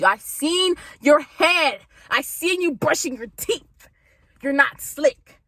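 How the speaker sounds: high, slow i seen your head; calling out. Retold or imitated. Friend calling out the frat, slow and sassy. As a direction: drag out each accusation.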